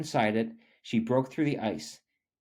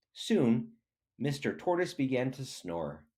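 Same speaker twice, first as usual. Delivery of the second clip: ordinary delivery, nothing marked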